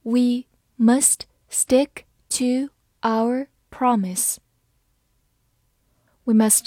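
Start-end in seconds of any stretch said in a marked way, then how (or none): 0.0-4.4 slow, careful model reading; slow demo for learners. Shown as a demonstration. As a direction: read it slowly for the class. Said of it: "好，慢慢来读。".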